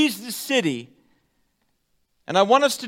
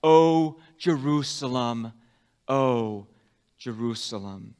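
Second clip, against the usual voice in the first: mournful cry; 8 semitones lower than usual. Acted. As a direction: echo the father's cry, sorrowfully. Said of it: "echoes of King David's cry".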